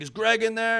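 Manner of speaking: high, loud question hollered at a house; mock visitor's shout; imitated demanding call. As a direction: call out up high, wanting an answer. Another armed man demands Greg.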